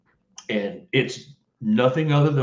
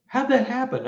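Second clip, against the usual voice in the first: high bewildered question; 13 semitones higher, 3 dB louder than usual. Retold or imitated. Car vanishing again.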